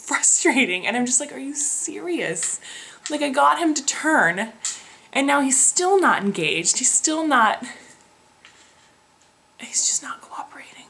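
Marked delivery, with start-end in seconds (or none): 1.3-2.6 exasperated disbelief. Retold or imitated. Exasperated at midwife's news. 9.6-10.8 whispered under her breath; exasperated whisper. Real, not acted. Venting to viewers about the uncooperative baby, whispered.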